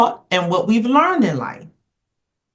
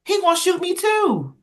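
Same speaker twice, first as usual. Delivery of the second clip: exasperated retort; 9 semitones higher than usual. Retold or imitated.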